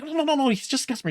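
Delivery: rapid, high-pitched "nothing to worry about"; hurried reassurance. Acted. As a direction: rattle it off to calm everyone down. Waving off the alarmed party.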